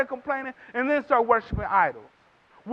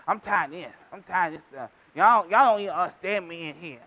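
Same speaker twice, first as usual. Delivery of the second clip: fed-up grumbling; 8 semitones lower than usual. Retold or imitated. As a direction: mutter it like a sulking spouse. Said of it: "talk crazy to our spouse".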